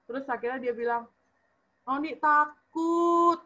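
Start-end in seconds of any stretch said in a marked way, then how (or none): 1.8-3.4 high, louder, drawn-out mock wail; retold cry out. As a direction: stretch it out slowly like a frightened cry. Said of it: "teriak gitu dia".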